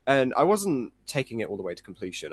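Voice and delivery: ordinary delivery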